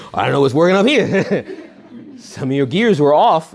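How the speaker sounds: real laughter breaking into the punchline; laughing. Real, not acted. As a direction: chuckle as you say it. Laughing at his own joke.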